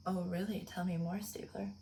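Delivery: soft, sneering near-whisper; mock sarcastic. Cop mocking Stabler, soft sarcastic whisper. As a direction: tease him quietly, dripping sarcasm.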